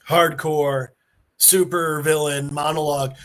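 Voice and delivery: usual speaking voice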